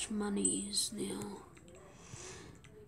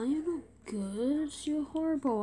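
usual speaking voice

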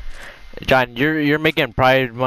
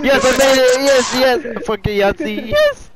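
high, excited victory shouting; real triumphant cheer; 11 semitones higher, 4 dB louder than usual. Real, not acted. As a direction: whoop it out like you just won. Celebrating making it in game.

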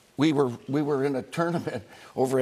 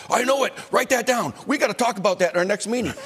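imitating John Madden; 7 semitones higher, faster than usual. Madden answering Mooch on the phone, excited and high. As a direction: fire off the orders like an eager coach. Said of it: "and then say".

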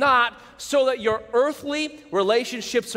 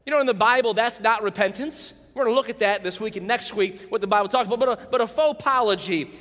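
ordinary talk, nothing marked

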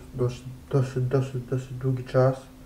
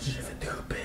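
whispering; 3 semitones higher, 11 dB quieter than usual. Real, not acted. Whispering at a tense game moment.

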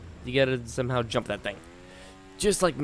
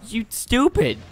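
angry outburst; 11 semitones higher, 6 dB louder, slower than usual. Real, not acted. Insulting the game.

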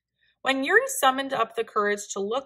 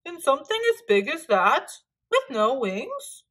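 loud, higher scoffing question; amused disbelief; 3 semitones higher, 4 dB louder than usual. A narrator's reading.

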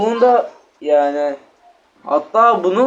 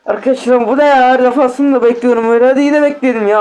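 loud exasperated complaint, pitched up; venting at the bad result, louder; annoyed outburst; 4 semitones higher, 4 dB louder than usual. Real, not acted. Kupa sonucuna sinirlenen oyuncu. (sinirli, yüksek sesle yakınma)

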